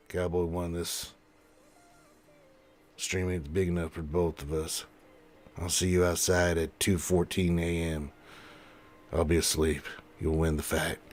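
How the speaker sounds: low cowboy challenge; put-on gunslinger voice; cowboy showdown. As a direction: issue a mock Western duel challenge, pitched low. Cowboy showdown read from chat.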